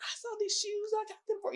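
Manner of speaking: high, bright-eyed auntie voice; imitating auntie. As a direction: mimic the generous auntie gushing. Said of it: "She be buying stuff for people".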